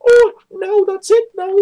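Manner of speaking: hammed-up falsetto imitation of her gushing; mock freak-out. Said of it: "And she's like".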